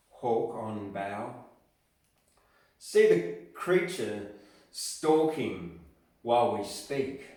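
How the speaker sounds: slow, measured poetry reading with swelling lines; dramatic poetry reading, slow and rising on key lines; poem recitation. Acted. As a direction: recite it like a tender love poem, lingering on each love. Recites a Browning love poem.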